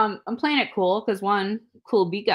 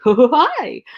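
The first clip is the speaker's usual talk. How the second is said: loud, high mock hello; startling greeting; 5 semitones higher, 9 dB louder than usual. Going up to her car window, loud startling greeting. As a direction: pop up with a too-big hi.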